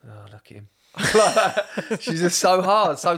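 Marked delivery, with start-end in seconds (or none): 0.1-0.7 nan's flat aside, much softer; mimicking nan. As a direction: breathe it out like a hard old nan at a deathbed. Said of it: "She's like".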